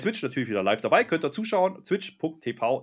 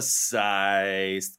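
drawn-out announcer voice; 8 semitones lower, much slower than usual. Pretend. Hyping the episode title.

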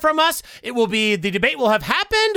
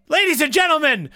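ordinary talk

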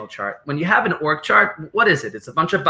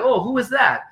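higher-pitched, pleasantly surprised question; curious surprise; 8 semitones higher than usual. Acted. Traveler abroad hearing a New York accent, curious surprise. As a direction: perk up at a voice behind you. Acted reaction to overhearing familiar accent.

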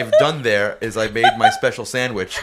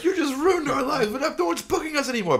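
mock whiny complaint, pitched way up and laughing; high-pitched, laughing mock grievance; angry fan complaint. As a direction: squeak it out like a wounded fan, laughing through it. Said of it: "be like".

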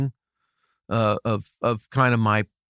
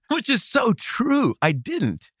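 laughing; 12 semitones higher, 3 dB louder, slower than usual. Real, not acted. Laughs at his own ignorance.

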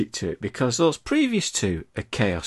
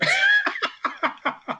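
shrieking laugh. Real, not acted. Big laugh.

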